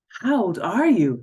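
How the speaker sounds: high, loud startled question; alarmed at her age; shocked question. A narrator's reading. Philip asks the girl her age, startled.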